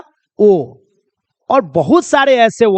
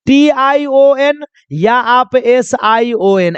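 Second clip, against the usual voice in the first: letters spelled out one by one, slowly; slow, deliberate letter by letter; slow spelling out; much slower than usual. Shown as a demonstration. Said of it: "या ऐसे समझ लो ना".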